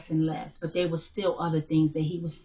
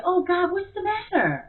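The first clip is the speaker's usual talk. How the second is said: alarmed; 13 semitones higher, 4 dB louder than usual. Retold or imitated. Mother alarmed at son's cry.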